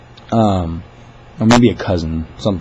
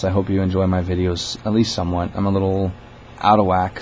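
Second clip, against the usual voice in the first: ordinary talk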